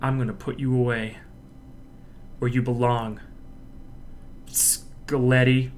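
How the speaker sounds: quiet, controlled lawyer threat; calm certainty, no rush; confident lawyer, softly menacing; contained confident. Shown as a demonstration. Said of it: "more contained and confident".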